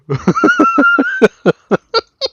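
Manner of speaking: a loud, high burst of laughter. Real, not acted. Telling of hiding illness from wife, loud laugh. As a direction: crack up at the punchline. Laughing at his own story.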